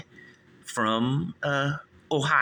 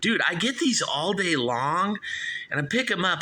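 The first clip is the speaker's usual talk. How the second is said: ordinary talk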